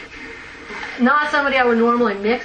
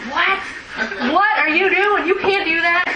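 much higher, laughing disbelief at a bad idea; shocked sponsor; 9 semitones higher than usual. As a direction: squeal it out in amused outrage. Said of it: "she was like".

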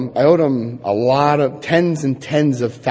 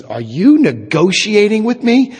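a higher, slower, disbelieving question; the official can't believe he's bargaining, pitched up; incredulous official; 6 semitones higher, 3 dB louder, slower than usual. Retold or imitated.

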